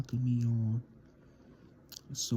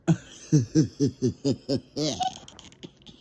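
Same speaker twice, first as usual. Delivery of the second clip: real laugh; 3 semitones higher, 4 dB louder than usual. Real, not acted. Laughing after signing off.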